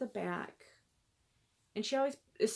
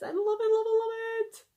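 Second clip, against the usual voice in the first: high, louder gushing; excited squeal; 5 dB louder than usual. Real, not acted. Vlogger gushing over a handmade gift, high excited squeal. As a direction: squeal it with delight.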